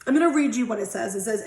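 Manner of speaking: usual speaking voice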